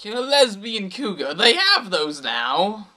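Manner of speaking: high-pitched, stretched-out astonishment; amazed disbelief. Acted. Friend reacting to the cougar's revelation, amazed disbelief. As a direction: blurt it high, then draw out the surprise.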